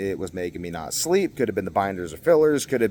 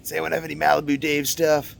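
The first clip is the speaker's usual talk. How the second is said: mock door-knocking ask; 7 semitones higher than usual. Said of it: "be like".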